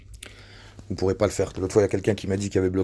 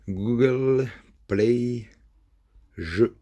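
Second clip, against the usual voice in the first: slow, stretched, higher Google Play; drawn-out; 4 semitones higher, much slower than usual. Shown as a demonstration. Typing it in slowly.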